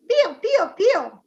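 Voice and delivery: high, loud squeaky pio pio like a chick; playful chick call; chick peeping. Shown as a demonstration. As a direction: chirp it out like a little chick. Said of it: "what's the sound of a chick in Italian".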